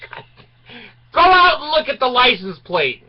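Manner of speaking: high, loud fed-up command; exasperated order. Retold or imitated. Teen sends the attendant outside to check, exasperated. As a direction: tell him to go look, fed up. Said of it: "I said".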